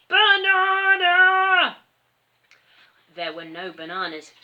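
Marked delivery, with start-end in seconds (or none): 0.1-1.8 long booming ape bellow; gorilla roar. A narrator's reading. Gorilla shaking the tree, bellowing slow. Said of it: "roared the giant gorilla".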